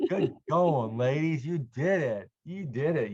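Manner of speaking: bright, loud cheering for the class; enthusiastic praise. Real, not acted. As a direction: celebrate their success out loud. Teacher praising students' success.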